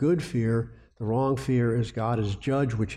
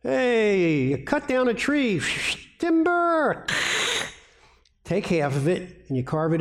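mock call from Isaiah; 9 semitones higher than usual. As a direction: playfully shout it like a lumberjack. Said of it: "and Isaiah goes".

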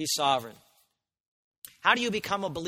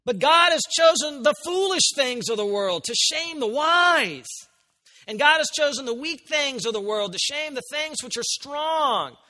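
higher, louder proclaiming of scripture; preaching at full force; 9 semitones higher, 7 dB louder than usual. Acted. Preacher proclaiming scripture to the church, loud and high. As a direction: thunder it out as declaration.

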